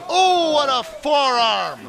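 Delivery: slow, loud drawn-out "oh"; excited reaction. Real, not acted. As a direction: react big to the hit. Big hit reaction.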